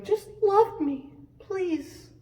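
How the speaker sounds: high, slow mock pleading voice; hammed-up begging for love; pleading Leah. Leah begging Jacob to love her, high and pleading. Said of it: "Leah ... looking at Jacob and going".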